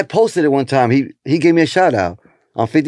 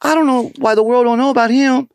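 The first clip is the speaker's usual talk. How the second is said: much higher, marveling; amazed quote; 11 semitones higher than usual. Retold or imitated. Comic remembering watching Juan Villa, high amazed voice. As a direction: wonder aloud at an unknown talent. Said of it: "be like".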